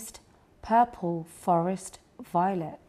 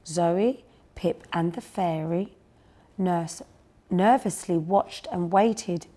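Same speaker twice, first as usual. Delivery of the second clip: plain reading voice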